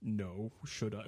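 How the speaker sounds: low, quiet, deadpan father imitation; mock stern dad, softly; retold reply. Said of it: "He's like".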